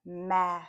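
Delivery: "ma" run together, vowel held; blended sounds. Shown as a demonstration. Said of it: "blend every phoneme".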